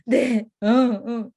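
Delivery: loud tearful "응"; imitating a sobbing toddler. 울던 아이가 선생님에게 울먹이며 대답하듯. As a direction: sob out the 응 like a small boy. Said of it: "반말하듯이 저한테".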